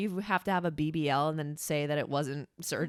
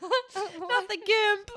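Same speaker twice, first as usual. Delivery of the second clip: slow, high, laughing squeal; 6 dB louder, much slower than usual. Real, not acted. Mishearing the Grinch joke, squealing high with laughter. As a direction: cry it out through laughter.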